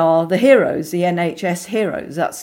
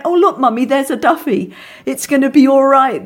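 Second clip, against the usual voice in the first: put-on higher, louder child's voice; lightly voiced little girl, pitched up; imitated little girl; 6 semitones higher, 5 dB louder than usual. Little girl reassured on spotting a nurse, lightly imitated child voice. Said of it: "I took my little girl in".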